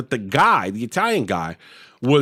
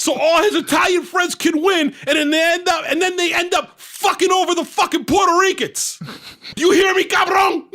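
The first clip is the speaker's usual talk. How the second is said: high, escalating shouted rant in imitation; hammed-up tirade at security; mock shout, pitched way up; furious accusation; 11 semitones higher than usual. Father rants on at guard.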